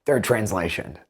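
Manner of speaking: ordinary talk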